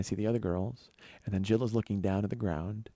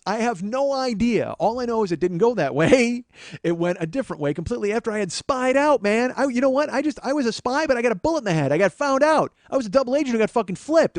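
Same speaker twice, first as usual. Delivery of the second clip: high, loud comic tirade; exasperated rant; 11 semitones higher, 7 dB louder than usual. Retold or imitated. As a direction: shout it out like a blown spy. Acted rant over ruined surprise.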